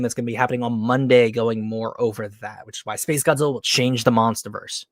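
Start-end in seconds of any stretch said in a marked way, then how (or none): none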